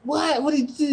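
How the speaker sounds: squeaky imitation of an alarmed aunt; alarmed mom. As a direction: play the startled mom. Auntie reacting to the news.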